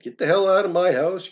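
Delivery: high, loud yell at the bird; angry yell. Retold or imitated. Reader yelling at the raven to get out, angry. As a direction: shout him out of the house.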